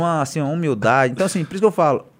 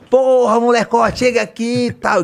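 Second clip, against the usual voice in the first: calls out loud and high, slow; shouting; 9 semitones higher, 7 dB louder, slower than usual. Retold or imitated. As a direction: yell it across the box like a star. (chamando alto, gritando)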